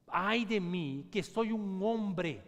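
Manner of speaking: drawn-out anguished cry; cried out. Acted. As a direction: cry it out slowly. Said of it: "gritó".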